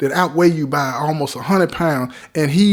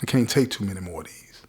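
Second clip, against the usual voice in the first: low, quiet retold self-talk; talking to himself; 6 semitones lower, 9 dB quieter than usual. Beaten man to himself after another beating, low inward resolve. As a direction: say it softly and low, as a private vow. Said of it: "I'm saying to myself".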